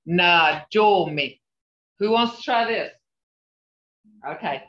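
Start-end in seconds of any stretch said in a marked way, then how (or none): 0.1-1.4 Najomi said slowly, syllables apart; slow syllable model. Shown as a demonstration. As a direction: draw out Najomi for the class.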